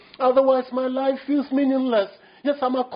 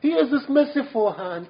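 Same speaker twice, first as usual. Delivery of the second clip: usual speaking voice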